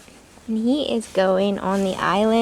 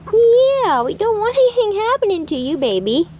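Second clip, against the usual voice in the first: pet talk; 9 semitones higher than usual. Real, not acted. Cooing to the chicken.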